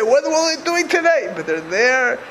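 ordinary talk, nothing marked

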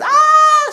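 a sudden high, loud sung "ah"; sung high note. Shown as a demonstration. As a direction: belt out one high note to show the singing stage. Singing at the higher stage.

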